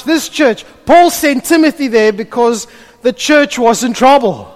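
loud, high full-force preaching. Acted. Pastor preaches to the church about Paul, at full force. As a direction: drive the point home to the congregation.

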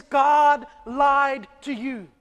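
slow, loud, sneering tempter; Satan's accusation. Retold or imitated. Preacher playing Satan tempting Eve, slow loud sneer. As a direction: lean in and drawl the lie out loud. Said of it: "says Satan through the snake, he says".

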